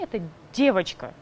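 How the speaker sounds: ordinary talk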